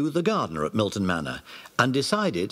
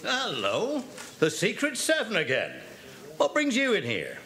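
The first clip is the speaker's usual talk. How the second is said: put-on higher, friendly police inspector greeting the children; 9 semitones higher, 3 dB quieter than usual. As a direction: play the amused local inspector. Narrator voices the police inspector.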